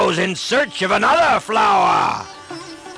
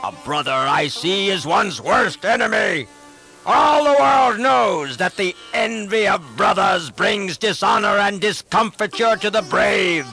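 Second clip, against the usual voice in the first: a king's angry tirade, raised; raging shout; 5 semitones higher than usual. Acted. Ravan raging at his brother Vibhishan, angry raised shout. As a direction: let the royal rage spill over. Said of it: "and he shouted".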